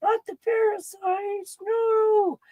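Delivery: high, slow squeaky cry of the doomed parasites; mock parasite panic. Vlogger jokes about garlic killing parasites in a high squeaky plea. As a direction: voice the parasites begging, tiny and high.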